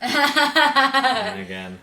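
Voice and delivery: very high, very slow and loud; high drawn-out. Acted. Host extending the joke, very high and very slow. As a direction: stretch it up high to milk the joke.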